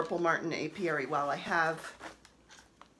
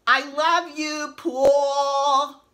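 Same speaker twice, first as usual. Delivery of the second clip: loud, higher, slow swooning yell; mock fangirl shout; 7 semitones higher, 10 dB louder, much slower than usual. Girl in the seats shouting to Paul, mock Long Island shout. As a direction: scream it at the stage like a teen fan. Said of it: "this young lady shouts out".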